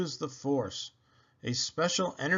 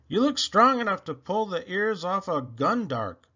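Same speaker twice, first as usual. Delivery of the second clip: lightly voiced playful jab, higher; a friendly tease, a touch higher; teasing; 6 semitones higher than usual. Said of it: "Han teased him".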